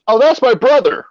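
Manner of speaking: high, earnest mock-innocent voice; innocent explanation. Friend fooling the cop, high innocent voice.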